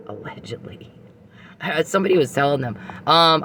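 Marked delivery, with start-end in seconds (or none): 0.1-0.9 sly whispered hedge; whispered aside. Acted. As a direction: drop to a whisper for the disclaimer. Sarcastic legal hedge aside.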